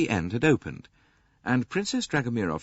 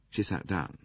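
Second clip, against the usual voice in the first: ordinary delivery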